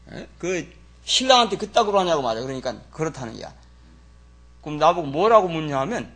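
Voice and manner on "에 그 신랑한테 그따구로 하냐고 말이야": scolding. Retold or imitated. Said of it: "호통을 막 치면서 말이야".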